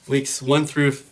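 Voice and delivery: usual speaking voice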